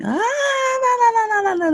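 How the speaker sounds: put-on high crying wail of a toddler in a tantrum; child's tantrum wail. A parent imitates her child's tantrum, high wailing cry. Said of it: "the child starts screaming".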